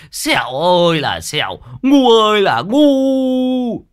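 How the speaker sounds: high, drawn-out exasperated wailing; despairing wail. Acted. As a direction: wail and curse your idiot accomplice. Cursing a foolish accomplice in despair.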